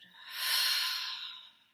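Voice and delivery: a long, loud open-mouthed sigh. Shown as a demonstration. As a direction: breathe out with a full audible sigh. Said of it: "Open up the mouth and sigh it out".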